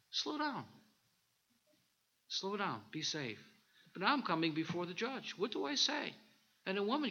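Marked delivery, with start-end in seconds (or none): 0.1-0.7 high, soft warning voice; a high little voice inside saying slow down; inner voice. Retold or imitated. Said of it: "This little thing that just said". 2.3-3.4 gently repeating the warning, softer; softer inner voice. Retold or imitated. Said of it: "This little thing that just said".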